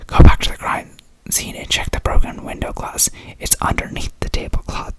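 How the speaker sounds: put-on storyteller whispering in a British accent; whispered British accent. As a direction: read the detective's solution in a whispered British accent. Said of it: "Okay, back to accent, in character".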